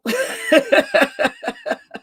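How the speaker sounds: rising laugh at her own rant; high laugh. Real, not acted.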